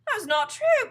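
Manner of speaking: high, loud denial; protesting cry. A narrator's reading. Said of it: "cried Missus Twit".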